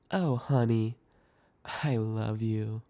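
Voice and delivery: soft, slow, mildly patronizing; lightly condescending endearment. A narrator's reading. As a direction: talk down with drawn-out affection. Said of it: "in a condescending tone".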